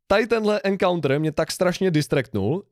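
usual speaking voice

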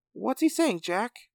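high excited questioning voice; Mighty Maw voice. Acted. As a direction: pitch it up high and eager. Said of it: "Mighty Maw asked".

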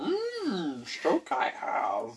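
high, very slow little Yoda; Baby Yoda voice. Retold or imitated. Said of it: "No, that's Baby Yoda".

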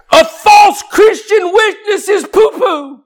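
loud, high, slow shout; full-throated pulpit shout; shouted climax. Real, not acted. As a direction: hammer the point home.

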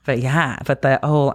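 normal talking voice

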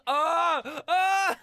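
high, loud mock wails; exaggerated fake-sounding screams; [name]. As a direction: yell out as if faking a scream. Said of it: "he was faking it".

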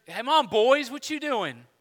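high, casual mock call; calling little boys. Jesus hailing the fishing men as little boys, mock familiar call. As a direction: call out playfully as if to kids. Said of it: "like him calling them little boys".

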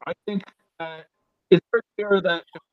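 muffled and very faint; covered microphone. Real, not acted. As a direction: hand over the mic. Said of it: "you're covering your microphone or something".

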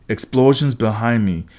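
ordinary delivery

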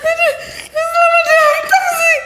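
slow high sing-song greeting, laughing; laughing, sing-song shout. Real, not acted. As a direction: belt it out while cracking up.

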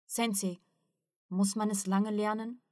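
ordinary delivery

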